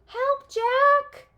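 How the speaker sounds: very high, loud frightened cry; loud panicked cries; cry for help. A narrator's reading. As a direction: call for help from far away. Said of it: "Her cry seemed fainter".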